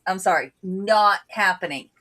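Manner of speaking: ordinary delivery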